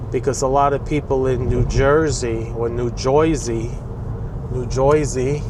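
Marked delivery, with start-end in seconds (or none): none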